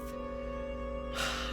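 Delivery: long breathy exhale of relief; long relieved sigh. Acted. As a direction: let out a slow relieved breath. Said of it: "exhaled a long breath".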